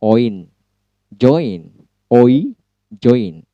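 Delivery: "join" said with a clear oi glide, repeated; word demo. Shown as a demonstration. Teacher drilling join for students. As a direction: drill "Oi, join" slowly for the class. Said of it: "seperti dalam kata join".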